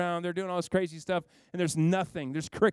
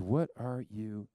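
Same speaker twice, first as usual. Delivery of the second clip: slow, much lower and softer divine voice; God gently confronts the fleeing prophet; still small voice; 8 semitones lower, 4 dB quieter, much slower than usual. Acted. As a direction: let God ask it slowly and quietly. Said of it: "And God says".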